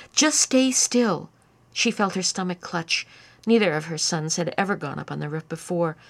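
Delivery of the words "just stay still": slow, higher, firm and careful; calm him down without scaring him; slow urgent plea. A narrator's reading. Mother urging son on roof.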